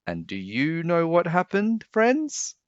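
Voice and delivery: put-on high, teasing ask to the kids; playful question. A narrator's reading. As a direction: tease the listeners with it. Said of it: "teasing question to listeners".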